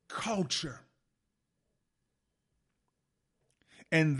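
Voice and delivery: loud declaiming speech to parliament; dramatic oratory. Acted. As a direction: proclaim it like a grand speech. Preaching the quote with force.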